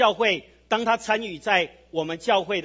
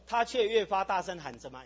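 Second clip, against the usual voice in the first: ordinary delivery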